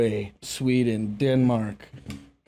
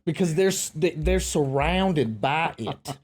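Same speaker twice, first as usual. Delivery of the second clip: hammed-up Southern accent; 3 semitones higher than usual. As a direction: lay on a thick Louisiana-Texan twang. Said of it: "that talks like this".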